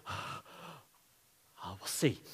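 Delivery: soft, breathy hesitant aside; David answering his men, hushed and unsure; hushed reply. Acted.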